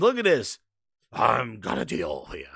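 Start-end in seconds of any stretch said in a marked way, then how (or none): 1.1-2.5 low, gloating wrestler voice; Million Dollar Man. Acted. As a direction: drop low like a wrestling villain. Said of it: "came out like the Million Dollar Man".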